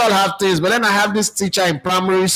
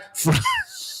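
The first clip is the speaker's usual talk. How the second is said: high burst of laughter; 5 dB quieter than usual. Real, not acted. Laughs at his own prediction list.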